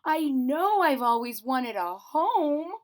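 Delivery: high, slow awed little bear; wondering teddy bear. A narrator's reading. Corduroy voiced in story.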